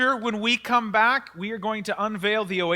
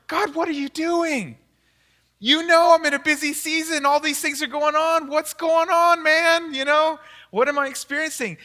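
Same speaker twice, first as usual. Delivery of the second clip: exasperated plea to God; 6 semitones higher, 3 dB louder than usual. Retold or imitated. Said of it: "I'm like".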